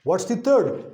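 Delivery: normal talking voice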